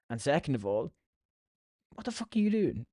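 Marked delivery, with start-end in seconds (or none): 1.9-2.9 high-pitched, incredulous challenge; retold indignant outburst. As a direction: act out catching someone red-handed. Confronts the thief in retold quote.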